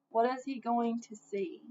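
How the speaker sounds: usual speaking voice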